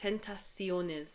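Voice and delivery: "tentaciones" drawn out syllable by syllable; slow, careful try at "tentaciones"; attempted Spanish word. Shown as a demonstration. Co-host retrying a Spanish word, slow careful "tentaciones". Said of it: "so that I can say that".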